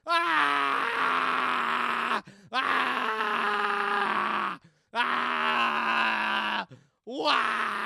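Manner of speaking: long held screams; loud screams. Acted. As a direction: scream loudly on and on. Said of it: "while screaming to survive".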